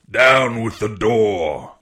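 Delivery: slow, drawn-out cry of a blind pirate; cried command. A narrator's reading. Blind beggar to his men at the inn door, slow cried command. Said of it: "he cried".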